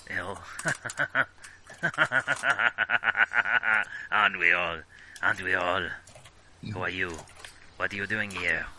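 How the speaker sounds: put-on heavy French accent, world-weary and bitter; a broken old Frenchman in a thick accent. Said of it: "incredibly accurate French accent".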